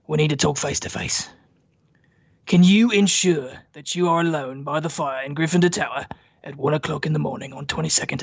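put-on Australian voice, measured and earnest; Australian accent. Podcaster performing Sirius's letter to Harry, Australian accent. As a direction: read the letter aloud in an Australian-leaning accent. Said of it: "not quite as Australian as your rendition".

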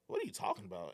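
high-pitched, puzzled comeback; baffled retort. Retold or imitated. Replying to girlfriend's accusation of a problem, baffled. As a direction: act out your bewildered reply.

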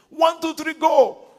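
loud, high call to start reading together; loud count-in. Shown as a demonstration. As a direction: shout the count so everyone starts at once. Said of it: "I say".

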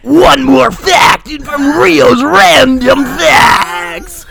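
slow, loud, high game show announcer. Pretend. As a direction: boom it out like a show intro.

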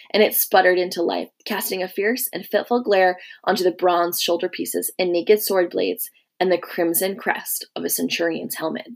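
usual speaking voice

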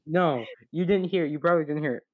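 ordinary delivery

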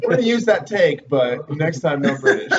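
mock director. Playing director giving note.

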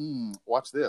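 low, soft menacing relish; vampire-like predator. Acted. Wolf eyeing her exposed neck, low vampire-like relish. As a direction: murmur it low like a hungry hunter. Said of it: "like a vampire".

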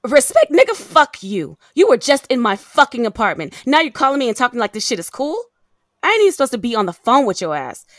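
loud, indignant telling-off; furious, punched swearing; angry tirade. Acted. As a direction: lash out at an ex who demands respect. Furious phone quarrel with abusive ex.

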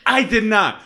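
high-pitched mock protest; acted-out indignant comeback; retold denial. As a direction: deny it emphatically, imitating him.

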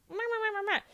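high, sing-song "meh meh" babble; mock gossip chatter. Gossiper rattling on to her sister, high mock babble.

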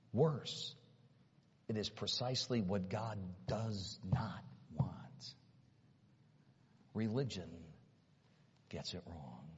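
hushed, breathy whisper of a warning; secret-telling sermon whisper. Real, not acted. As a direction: drop to a whisper to draw them in. Preacher drops to a whisper.

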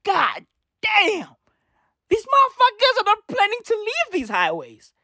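high, stunned exclaim; amazed. Retold or imitated. Watching protesters camp on highways, high amazed exclaim. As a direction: gasp it out, amazed. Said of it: "I was like".

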